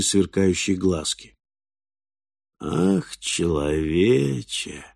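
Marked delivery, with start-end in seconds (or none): 2.6-4.8 slow, soft, drawn-out nasal creature voice; nasal drawling boar. A narrator's reading. Wild boar addresses the hidden man, nasal drawl. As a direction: drawl it through the snout. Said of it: "гнусаво протянуло создание".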